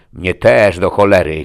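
loud, exasperated yell; shouting. A narrator's reading. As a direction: burst out over the quarrel. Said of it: "krzyknąłem". (krzyk, ze złością)

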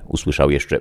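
plain reading voice

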